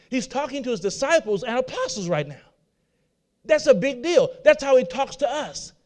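ordinary delivery

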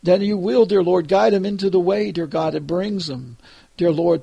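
ordinary delivery

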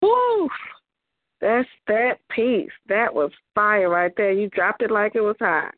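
ordinary delivery

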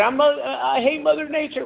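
high whiny mock grumble; mock complaint. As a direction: complain like a sulky person. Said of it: "So people say".

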